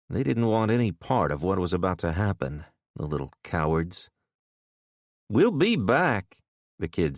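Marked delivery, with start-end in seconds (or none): 5.3-6.4 put-on threatening kid. A narrator's reading.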